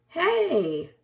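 sharp angry call of hey; a cross mole shouting up from his hole; angry cry. A narrator's reading. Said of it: "cried Mole angrily".

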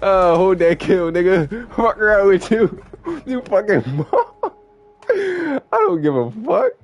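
high, loud trash talk broken by laughs; cackling, hyped-up trash talk after the kill; gleeful taunting. Real, not acted. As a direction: gloat over the kill.

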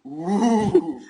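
high, loud burning groan; loud pained groan. Real, not acted. Reacting to hot pepper.

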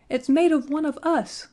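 high, loud outraged cry; scream. A narrator's reading. Chief dwarf spots the sealskin cap, screaming. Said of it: "he screamed".

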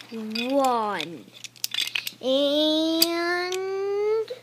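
shouted, rising on the last word; loud countdown. Acted. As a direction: call the count out hard. Countdown climax.

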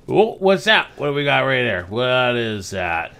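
high, loud sudden surprise; curious, then slowing down; surprised. Real, not acted. Spots something in the game.

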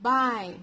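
BUY read as "b-eye" with a full long vowel; long I sound. Shown as a demonstration. Teacher reads the word to children, long I. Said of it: "this is read as".

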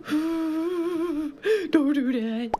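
very slow, soft crying plea; whimpering mock plea. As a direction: drag it out like a whimper. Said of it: "I'm like".

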